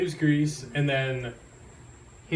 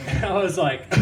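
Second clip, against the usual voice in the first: ordinary delivery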